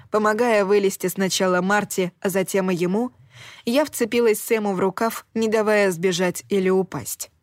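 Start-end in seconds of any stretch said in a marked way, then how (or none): none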